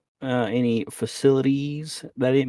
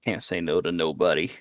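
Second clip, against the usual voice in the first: a lower, gruffer voice; lower gruff NPC; 7 semitones lower, 4 dB quieter than usual. Acted. Player reassures the old man, lower and gruff. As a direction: grumble it like a grizzled sailor. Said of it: "I'll be like".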